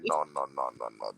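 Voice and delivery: turning down the offer under his breath; mock refusal. To his cousin offering food, a low soft refusal.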